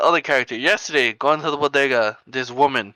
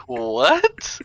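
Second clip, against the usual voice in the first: confused what; 11 semitones higher than usual. Retold or imitated. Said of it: "And I'm like".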